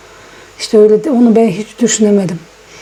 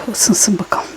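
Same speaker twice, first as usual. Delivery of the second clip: whispered aside while ill; 4 dB quieter than usual. Real, not acted. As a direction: breathe it out, barely voiced. Sick woman murmuring while cooking. (fısıltıyla)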